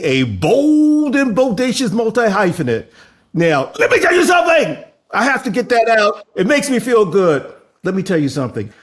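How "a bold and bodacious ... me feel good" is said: louder, higher, fast hype; hyped announcer. Acted. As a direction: pump up the crowd. Hyped host introduction.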